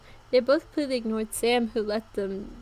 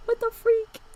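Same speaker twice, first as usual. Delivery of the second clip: high, laughing outburst; laughing disbelief; 11 semitones higher, 4 dB louder, slower than usual. Real, not acted. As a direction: crack up at the absurd line. Reacting to own writing laughing.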